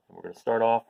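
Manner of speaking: plain reading voice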